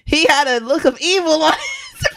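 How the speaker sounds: laughing while telling, high and loud; laughing through the words. Real, not acted. To her cohost about the evil man, laughing. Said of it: "laughing through the telling".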